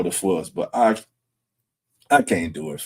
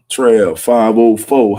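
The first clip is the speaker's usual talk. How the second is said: loud welcome; 10 dB louder, slower than usual. Real, not acted. Greeting a viewer joining the stream.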